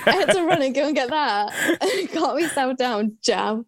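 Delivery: laughing while talking; chuckling through the story. Real, not acted.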